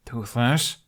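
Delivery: a firm rising warning on the name. A narrator's reading. Hiccup warns his dragon, firm rising warning. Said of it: "Hiccup warned".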